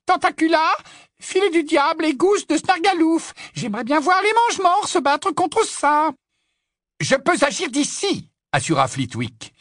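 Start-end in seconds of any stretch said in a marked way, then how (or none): 0.1-6.1 muttering witch. Acted. 7.0-8.3 high squeaky confident voice; squeaky little wizard. Acted. Flitwick voiced.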